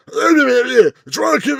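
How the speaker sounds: loud, high mocking gibberish of a bad comic; mock comic delivery, high and garbled; unintelligible comic. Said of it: "you can hardly hear him".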